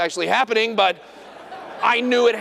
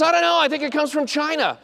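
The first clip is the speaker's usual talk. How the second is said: mock patient voice; 8 semitones higher than usual. Patient's voice acted high.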